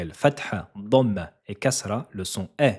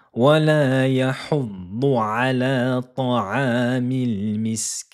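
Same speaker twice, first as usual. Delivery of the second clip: Quran recitation; 3 semitones higher, 4 dB louder than usual. Shown as a demonstration. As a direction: recite the verse in melodic chant.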